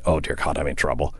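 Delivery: soft, low, private "oh no" moment; inner panic. Retold or imitated. Panicked inner thought on phone.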